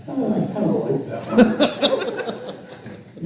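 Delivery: fast, quiet, laughing aside. Real, not acted. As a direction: chuckle through the words. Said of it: "Make myself laugh".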